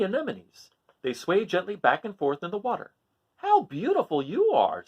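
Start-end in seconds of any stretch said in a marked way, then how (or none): 3.4-4.8 higher, slow admiring voice; admiring crab. Acted. Hermit Crab admires the sea anemones, slow and higher. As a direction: linger on the praise. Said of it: "said Hermit Crab".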